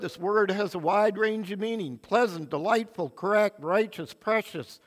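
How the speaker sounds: ordinary talk, nothing marked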